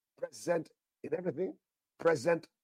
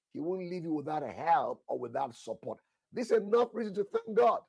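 normal talking voice

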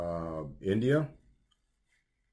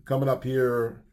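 ordinary talk

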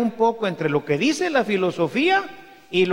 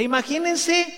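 normal talking voice

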